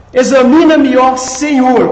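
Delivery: "Examina-me, ó Senhor" with loud, high punched tonic syllables; teacher loudly stressing "Examina-me, ó Senhor"; tonic stress demonstration. Shown as a demonstration. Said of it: "Qual é a tônica aí?".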